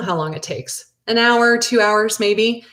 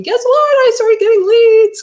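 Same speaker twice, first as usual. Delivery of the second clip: imagined excited coworker; 8 dB louder than usual. Pretend. Coworker Jill imagined announcing her first leads, giddy. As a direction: burst with excitement over the good news. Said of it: "she starts telling me".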